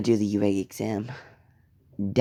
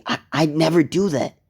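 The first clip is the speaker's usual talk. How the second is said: higher, louder, pleading denial; falsely accused boy protesting, higher and louder; desperate denial; 7 semitones higher, 4 dB louder than usual. Acted. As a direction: blurt it out in a panic.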